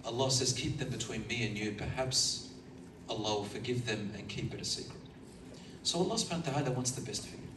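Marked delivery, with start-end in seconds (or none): 0.5-5.0 soft and confiding. Acted. Allah speaking of keeping secrets.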